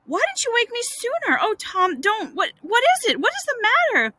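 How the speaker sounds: high, panicky questioning; alarmed. Acted. Brother pleading with the groaning boy, high and alarmed. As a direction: fret in a high, worried voice.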